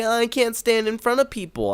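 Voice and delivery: high, far-off timid voice; mock the anxious public speaker; shy nervous type. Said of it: "I'm not the type of, like".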